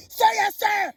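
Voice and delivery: high, barked mock order from a cop. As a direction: snap it out like a bossy cop. Cops demanding obedience, retold.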